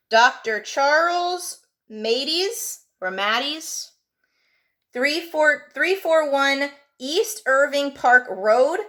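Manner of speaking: slow, clear dictation; dictating. Shown as a demonstration. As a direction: read it out slowly for students to write. Teacher dictating an address slowly.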